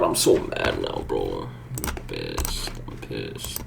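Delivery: low seething. Real, not acted. Seething after the miss.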